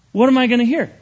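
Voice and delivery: ordinary delivery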